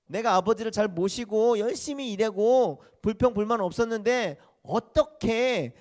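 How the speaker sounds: high, loud resentful complaint; aggrieved eldest son. Acted. Eldest son complains to father, loud and resentful. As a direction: protest how unfair it is. Eldest son's grievance voiced.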